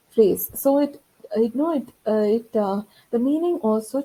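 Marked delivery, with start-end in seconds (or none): none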